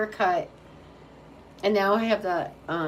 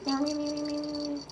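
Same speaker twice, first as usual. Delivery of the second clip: much higher playful meows; mock meowing; 9 semitones higher, faster than usual. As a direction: meow at the cat like a kitten. Meowing at the pet.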